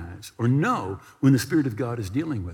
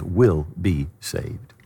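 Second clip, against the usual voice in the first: "Will be saved" drawn out, each word weighted; slow emphatic quote; 6 semitones lower, much slower than usual. Acted. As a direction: land "saved" slowly and firmly. Quoting scripture with emphasis.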